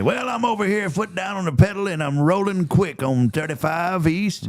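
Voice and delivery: CB trucker on the radio; over walkie talkie. Pretend. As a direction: call it in like a trucker. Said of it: "Was that okay or not really?".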